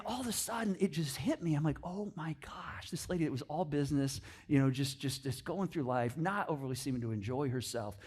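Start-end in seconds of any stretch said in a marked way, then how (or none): 1.8-7.9 hushed realization. Retold or imitated. Pastor recalling his realization about the flight attendant, hushed and awed. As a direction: let it dawn on you quietly, almost to yourself.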